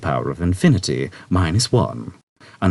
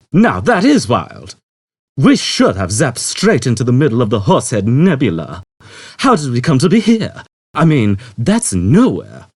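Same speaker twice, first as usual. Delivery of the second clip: Zaphod, amazed; 6 semitones higher, 6 dB louder than usual. A narrator's reading. Zaphod marvelling to Trillian, loud and amazed.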